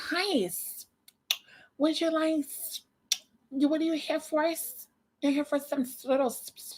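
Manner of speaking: mock shop girl with long whistling s's; hissing S sounds. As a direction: exaggerate the sibilants on "Hi, s-". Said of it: "she's that way, girl. She's like".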